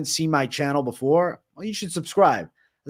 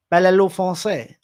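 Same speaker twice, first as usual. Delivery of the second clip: mock French; 5 semitones higher, 5 dB louder than usual. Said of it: "It's French".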